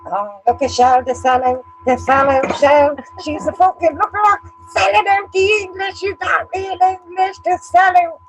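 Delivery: high, loud jeering voice; shrill-sounding mimicry of the critics calling her a sellout; mocking taunt. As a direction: sneer like a playground mocker. Mocking critics in a retold quote.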